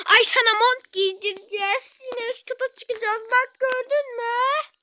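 cooing then chiding like a fussy mum; loud high mother voice; fussing mother. Pretend. Mother voice to child.

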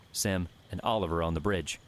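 plain reading voice

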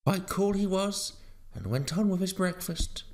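high-pitched dog voice; gossiping dog. A narrator's reading. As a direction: report the master's coolness in a high voice. Dog boasts of master to village.

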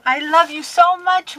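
normal talking voice

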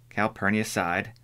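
ordinary delivery, nothing marked

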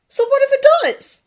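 very high, loud, defiant retort; angry hiss. A narrator's reading. Draco defending his loyalty, angry. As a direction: lash back at her. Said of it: "Draco hissed".

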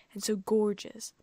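ordinary delivery

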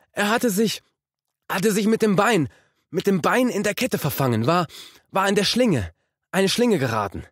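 high, loud, gasping stammer; breathless stammering. A narrator's reading. Diver back from the water, stammering out of breath. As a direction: stammer it out, winded and shaken. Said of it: "völlig außer Atem, riss sich das Mundstück runter und stammelte".

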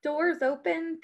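plain reading voice

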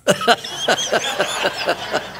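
breathy delighted laugh. Real, not acted. Son's sweet remark.

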